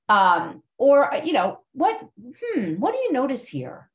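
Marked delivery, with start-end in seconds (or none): 1.7-3.9 high, musing "What, hmm", pause, then "what do you notice here"; inflection with pauses. Shown as a demonstration. Yoga teacher wondering aloud with students, inflected and pausing. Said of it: "I'm using inflection. I'm maybe pausing".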